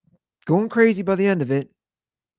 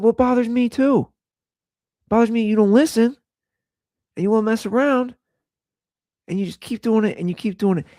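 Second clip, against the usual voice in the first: raised, higher-pitched scolding; exasperated dad; 6 semitones higher, faster than usual. Retold or imitated. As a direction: plead with a child, fed up. Acts out exasperated dad scolding daughter.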